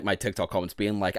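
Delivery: usual speaking voice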